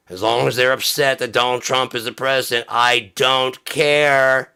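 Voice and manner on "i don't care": drawn-out care. Acted. Mock Trump voter rant.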